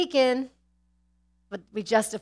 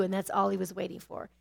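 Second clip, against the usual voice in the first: ordinary talk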